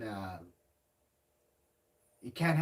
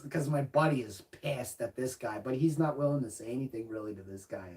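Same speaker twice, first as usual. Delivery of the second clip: ordinary delivery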